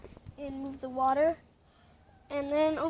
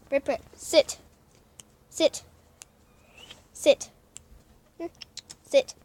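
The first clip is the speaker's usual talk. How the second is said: firm dog command. Real, not acted. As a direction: order the dog to sit.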